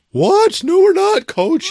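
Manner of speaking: put-on high, loud outraged kids' voices; shrill kids insisting they're innocent; protesting kids. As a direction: squeal it like indignant children.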